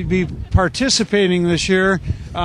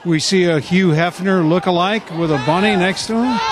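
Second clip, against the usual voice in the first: announcer calling over a noisy crowd; live event commentary, raised voice; live play-by-play; 3 semitones higher than usual. Real, not acted.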